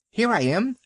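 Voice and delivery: high, sharp little dog voice; yapping little dog. A narrator's reading. Vixen the dog calling to the animals, high and yappy. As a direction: yap it out proudly. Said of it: "yapped Vixen".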